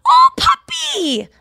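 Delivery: very high, loud, drawn-out coo; hammed-up adoring shriek; imitated gushing squeal. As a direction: gush over a dog in a mocking squeal. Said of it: "They love animals so much".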